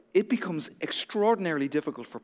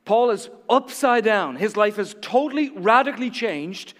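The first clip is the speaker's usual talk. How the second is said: louder, higher, driving sermon voice; full-force preaching; 5 semitones higher, 5 dB louder than usual. Acted. Pastor to the church, preaching at full force. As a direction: preach it out, building to the climax.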